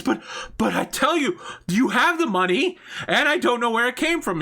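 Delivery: terrified victim yelling to the mob boss about the money; panicked pleading. Acted. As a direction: scream that you don't know.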